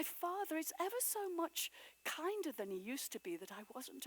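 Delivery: put-on high, quick, breathless girl's chatter; excited little girl. A narrator's reading. Little Fan tells her brother the news, high and rushing. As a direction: rattle it off like a thrilled child.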